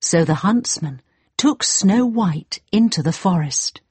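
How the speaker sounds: ordinary delivery, nothing marked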